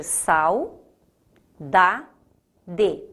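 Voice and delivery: "sal, da, de" as three separate beats, sau-da-de; syllable by syllable. Shown as a demonstration. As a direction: pause after each syllable of "sal, da, de". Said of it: "se eu separar saudade em sílaba".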